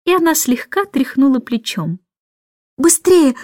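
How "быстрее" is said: higher, urgent call; agitated cry. A narrator's reading. Michael hurrying the lark away, agitated cry. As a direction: urge someone on in excitement. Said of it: "взволнованно закричал Майкл".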